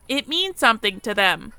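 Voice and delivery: ordinary talk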